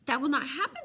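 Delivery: loud, high fervent vow. A narrator's reading. Alan to the old man, fervently denying he'd stray. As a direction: insist with all your heart. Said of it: "said Alan fervently".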